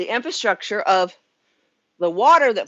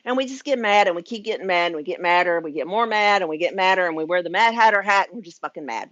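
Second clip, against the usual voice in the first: ordinary talk, nothing marked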